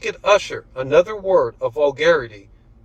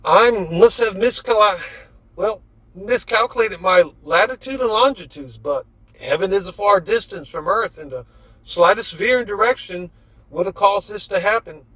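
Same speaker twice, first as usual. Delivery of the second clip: Leonardo voice; 6 semitones higher than usual. A narrator's reading. Leonardo explains excuse.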